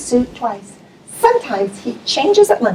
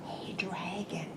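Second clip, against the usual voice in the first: slow, soft whisper; a princess whispering to a sleeping dragon; whispered softly; 5 semitones lower, 27 dB quieter, slower than usual. Acted. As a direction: breathe it gently. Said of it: "Elizabeth whispered very softly".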